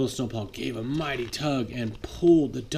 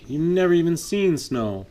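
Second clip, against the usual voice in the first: higher, teasing taunt; dump truck taunting; 6 semitones higher, 4 dB louder than usual. Acted. Dump truck taunting the snowplow, mocking. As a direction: rub it in. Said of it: "said the dump truck".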